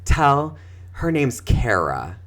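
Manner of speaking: Kara as CARE-uh, slowly; intended name. Retold or imitated. Next-door teacher clarifying the name, intended name. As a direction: draw out Kara with an AIR vowel. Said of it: "hysterically laughing. She's like".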